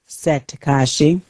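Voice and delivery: ordinary delivery, nothing marked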